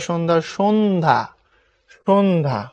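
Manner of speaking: "সন্ধ্যা" slowly as shon-dha; সঠিক উচ্চারণ. Shown as a demonstration. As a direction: draw out the n in "সন্ধ্যা". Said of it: "সঠিক উচ্চারণ". ("সন্ধ্যা" ধীরে, শোন্-ধা)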